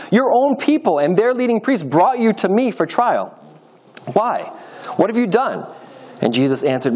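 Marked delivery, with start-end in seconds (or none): 0.1-5.7 high, insistent interrogation; retort. A narrator's reading. Pilate questions the prisoner brought for trial, insistent retort. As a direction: press the prisoner for an answer. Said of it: "Pilate retorted".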